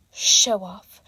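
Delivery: low, muttered grumble of a rival; a lightly voiced grudging jab; grumbled. A narrator's reading. Imagined rival grumbling at his showing off, low and grudging. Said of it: "Monoma's grumble".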